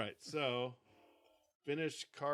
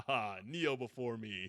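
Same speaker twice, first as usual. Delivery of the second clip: booming arrogant alien overlord; 2 semitones lower than usual. Pretend. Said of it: "he's like General Zod".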